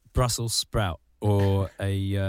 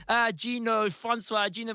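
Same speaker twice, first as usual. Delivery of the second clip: higher sing-song teasing, rising at the end; taunting schoolkids; 11 semitones higher than usual. Retold or imitated. Classmates mocking his middle name in the playground. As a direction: tease the name like a playground bully. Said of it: "we'd be like".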